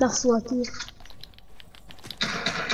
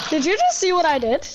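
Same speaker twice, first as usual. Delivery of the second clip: laughing; 4 semitones higher than usual. Real, not acted. Laughing at own play.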